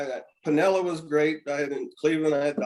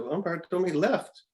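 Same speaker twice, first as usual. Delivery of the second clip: sheepish; 5 semitones higher than usual. Retold or imitated. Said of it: "I said".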